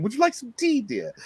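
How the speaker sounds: high, soft, sugary tea offer; mock-sweet offer. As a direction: coo it softly as a mock-gracious hostess. Sweet offer after the mock threat.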